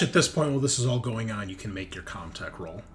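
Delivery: usual speaking voice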